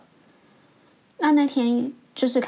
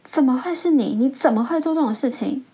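higher, disbelieving retold questions; incredulous mock reproach; shocked teacher; 4 semitones higher than usual. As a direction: imitate a teacher stunned by the model pupil. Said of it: "眼睛真的是快掉下来".

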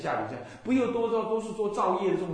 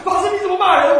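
much higher and louder, shocked outcry; indignant shout; 12 semitones higher, 14 dB louder than usual. Retold or imitated. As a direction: yell back in offended disbelief. Caller protests being scolded. (被骂的信徒在电话里大声抗议)

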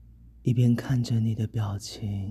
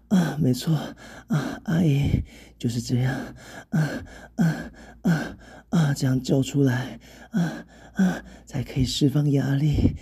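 higher, louder, broken by moans; urging through moans; 5 semitones higher, 4 dB louder than usual. Acted. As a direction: urge them on between moans. Acted urging between moans. (边喘边大声鼓励)

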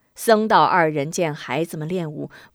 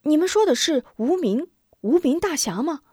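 put-on higher, startled child's question; startled boy; 8 semitones higher, faster than usual. A narrator's reading. Boy startled to hear the elders mention the hero. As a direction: catch your breath at the name. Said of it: "心中一愣".

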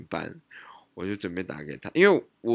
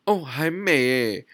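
slow, drawn-out hesitant reply; 5 semitones higher, 6 dB louder, slower than usual. Retold or imitated. Sister admits to Mark she forgot, hesitant. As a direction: stall a little before admitting it. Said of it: "她有一些犹豫".

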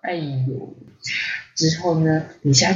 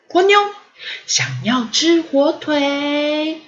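put-on spirited little girl; 8 semitones higher, slower than usual. A narrator's reading. Ponyo asking Lisa for ham, spirited. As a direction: answer full of pep in a high child voice. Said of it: "波妞便很有精神的回答说".

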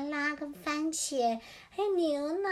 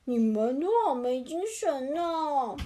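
slow, louder storybook dad; hammed-up father bird voice; father rooster; 5 dB louder, slower than usual. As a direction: draw it out like a concerned dad. Father hen remarks on glum chicks.

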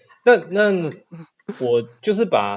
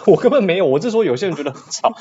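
laughing, louder and faster; laughing denial; 7 semitones higher, 8 dB louder, faster than usual. Real, not acted. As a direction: protest through laughter. Denying teasing accusation, laughing.

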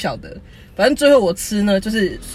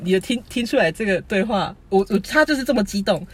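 usual speaking voice

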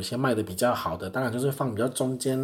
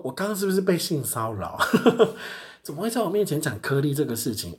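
higher, incredulous question; shocked disbelief; 6 semitones higher than usual. Retold or imitated. Clerk asking her coworker, shocked disbelief. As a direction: ask in stunned disbelief. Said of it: "跟着我同事讲说".

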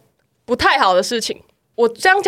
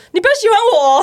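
mock smug; 8 semitones higher, faster than usual. Mimicking a smug straight girl.

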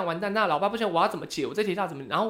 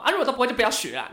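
angry retort; 7 semitones higher, 3 dB louder, faster than usual. Retold or imitated. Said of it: "然后我爸就很生气".

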